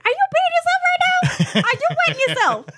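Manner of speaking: high, laughing imitation of a flustered uncle; mock shocked uncle. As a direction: squeak it out in disbelief.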